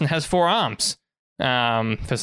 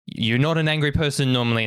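usual speaking voice

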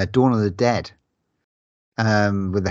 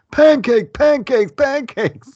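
squeaky, much higher mock child's chant; weird kid voice; 9 dB louder, much slower than usual. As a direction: ham up the bonkers little kid. Imitating the weird kid's line.

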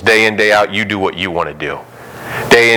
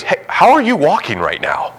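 pretend amazement; 9 semitones higher than usual. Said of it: "No one pauses and goes".

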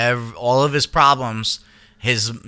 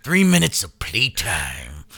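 bone saw villain; 6 semitones lower, 3 dB quieter than usual. Retold or imitated. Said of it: "you're the bone saw".

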